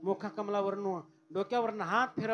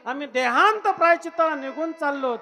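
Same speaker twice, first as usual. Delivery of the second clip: anguished plea; 7 semitones higher, 15 dB louder than usual. Acted. Mother begging her eldest son, loud and anguished. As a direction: plead from the depths.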